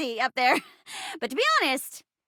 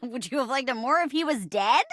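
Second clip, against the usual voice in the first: ordinary delivery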